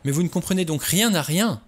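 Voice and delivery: cried out. A narrator's reading. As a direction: exclaim in frustration. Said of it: "s'écria Vera".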